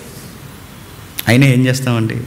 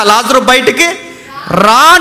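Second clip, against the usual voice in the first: commanding call; 8 semitones higher, slower than usual. Retold or imitated. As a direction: call Lazarus out with authority. Jesus calling Lazarus from tomb.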